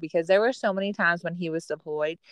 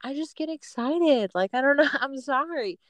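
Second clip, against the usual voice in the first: sheepish apology; 6 semitones higher than usual. Retold or imitated. Wife excusing herself to scolding husband, sheepish. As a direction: plead innocence in a small, high voice.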